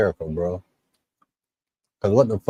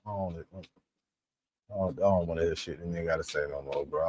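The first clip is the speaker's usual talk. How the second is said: much softer, trailing off in disgust; fed-up mutter; 4 semitones lower, 13 dB quieter than usual. Real, not acted. Commentator fed up with the clip's speaker, muttering much softer. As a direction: done with it, muttering under his breath. Fed up, refuses to hear more.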